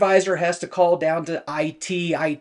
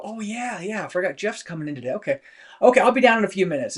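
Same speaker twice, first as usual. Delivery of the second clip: hurried; 3 semitones higher, faster than usual. Retold or imitated. Coworker who forgot the new hire.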